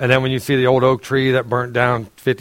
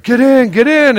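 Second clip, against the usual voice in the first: urgent rescuer; 10 semitones higher, 4 dB louder than usual. Acted. Boat rescuer urging the man.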